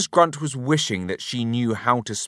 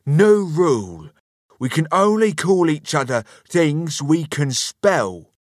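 loud, high, bossy husband voice; raised, argumentative tone; bossy insistence; 8 semitones higher, 6 dB louder than usual. A narrator's reading. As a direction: lay down the law loudly. Husband lays down a rule in argument.

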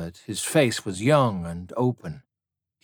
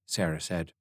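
plain reading voice

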